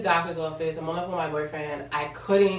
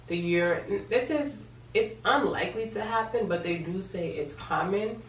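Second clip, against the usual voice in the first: ordinary talk, nothing marked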